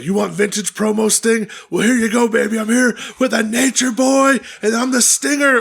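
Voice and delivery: high, excited wrestling promo; Sting promo voice. Retold or imitated. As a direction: hype it up like a wrestler cutting a promo. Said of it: "'Cause Sting was like".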